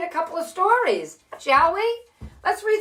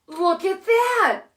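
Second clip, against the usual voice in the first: put-on higher, gasped look at that for kids; mock surprise; 7 semitones higher than usual. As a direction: feign delight at the new word. Surprise at new word has.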